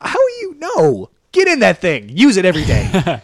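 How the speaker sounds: high, loud incredulous scolding; hammed-up, high-pitched exasperation; mock outrage. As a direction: scold them in exaggerated disbelief. Mock scolding of jet ski owners.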